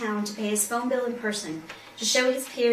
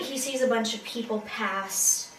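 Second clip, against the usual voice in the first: usual speaking voice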